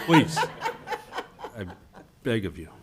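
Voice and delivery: high, comic begging; laughing plea; mock plea. Real, not acted. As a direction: beg the mayor jokingly. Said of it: "Please, Mister Mayor".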